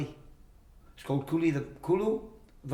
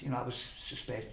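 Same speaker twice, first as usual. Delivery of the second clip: ordinary delivery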